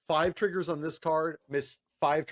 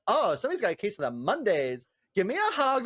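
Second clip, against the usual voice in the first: rising into a much higher playful plea; teasing cheery coworker; 6 semitones higher, 3 dB louder than usual. Retold or imitated. Coworker teases moody judges, lightly higher and playful. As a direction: coax them like a clingy friend. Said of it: "he thinks they're just being moody".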